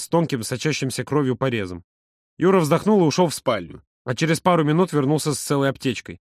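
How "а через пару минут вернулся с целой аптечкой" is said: plain reading voice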